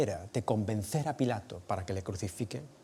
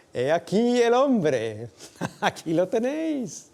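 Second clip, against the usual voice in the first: slow, high, louder proclamation with a laugh; Pilate presenting; 10 semitones higher, 6 dB louder, slower than usual. Acted. Pilate showing Jesus to the crowd, slow scornful proclamation. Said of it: "Y Pilato les dijo".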